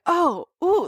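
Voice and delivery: imitating a flustered, swooning woman; hot and bothered. She reacts to his strawberry line, mock hot and bothered. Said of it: "she gets real hot and bothered".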